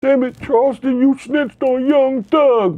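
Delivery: high, slow whiny fan voice; mock upset fan. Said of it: "people mad as shit at [name]".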